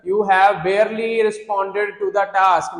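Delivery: words drawn out very slowly; slow examiner voice. Pretend. Examiner telling the teacher his score, very slow. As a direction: spell out the verdict slowly like an examiner. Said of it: "और कहा".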